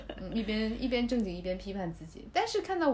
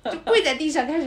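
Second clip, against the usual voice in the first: ordinary talk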